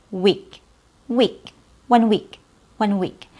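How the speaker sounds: week with a clipped short ee; too-short E. Shown as a demonstration. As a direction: cut the vowel in week short. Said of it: "making it too short".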